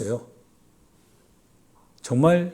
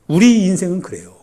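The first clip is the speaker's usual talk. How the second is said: loud, raised-pitch pronouncement; emphatic declaration; 4 semitones higher, 8 dB louder than usual. Real, not acted. Preacher telling congregation how life is, emphatic loud declaration. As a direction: proclaim it out to the congregation.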